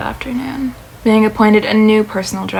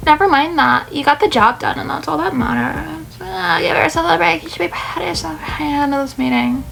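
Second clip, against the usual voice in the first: higher-pitched, gently encouraging voice; reassuring supporter; 5 semitones higher than usual. Acted. Friend cheering on a worried colleague, encouraging and higher. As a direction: reassure in a lifted character voice. Character comforts Chase.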